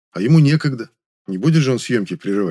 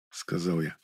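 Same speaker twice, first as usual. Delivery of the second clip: plain reading voice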